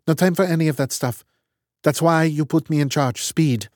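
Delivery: clipped and matter-of-fact, in charge; brisk woman's voice. Acted. Strat dismisses objections.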